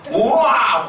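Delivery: high, loud imitated "wow" of delight; excited exclamation. Retelling friends spotting a minimarket, delighted exclamation. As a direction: burst out in mock amazement.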